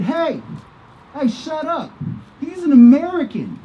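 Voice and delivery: mock the men yelling a challenge from their truck; hostile heckling. Men in a truck jumping on the honking driver, hostile.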